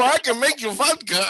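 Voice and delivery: put-on high, loud jokey Russian accent; mock Russian broken English. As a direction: make an eager offer in goofy pidgin Russian English. Goofing in broken English to foreigner.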